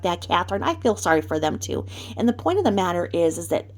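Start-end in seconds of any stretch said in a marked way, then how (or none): none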